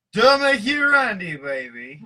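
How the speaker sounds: high, loud hammed-up come-on; mock seductive. Host teasing the cohost with a hammed-up come-on. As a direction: ham up a cheesy pickup line.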